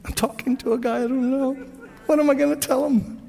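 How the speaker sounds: high-pitched, bewildered self-questioning; flustered. Retold or imitated. Preacher about to phone a stranger, flustered and high. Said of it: "I felt all kinds of strange".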